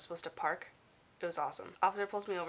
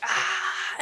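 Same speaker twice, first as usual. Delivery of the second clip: excited squeal; 8 dB louder than usual. Retold or imitated. Excited about going to Spain.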